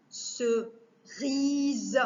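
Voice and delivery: "Cerise" slowly as Ce... ri-se; "Cerise" in spaced beats; split into syllables. Shown as a demonstration. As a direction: stretch and separate the syllables of "Cerise". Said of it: "couper les mots en syllabes".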